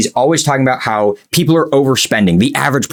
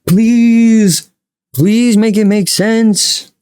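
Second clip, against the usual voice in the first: higher, hammed-up plea to the void; mock pleading; 6 semitones higher, much slower than usual. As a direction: beg Kevin theatrically, drawing out the last please. Mock plea to Kevin O'Leary.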